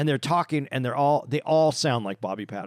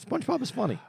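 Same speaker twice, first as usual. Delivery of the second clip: put-on childish defense; 11 semitones higher than usual. Defending SpongeBob playfully.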